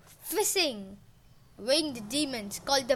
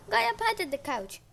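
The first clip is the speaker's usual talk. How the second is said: ordinary delivery, nothing marked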